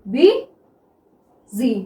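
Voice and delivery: "बी", long pause, "जी", as if dictating; syllable by syllable. Shown as a demonstration. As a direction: break "बी जी" in two, slowly, while writing it. Spells out syllables while writing.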